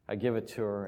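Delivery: normal talking voice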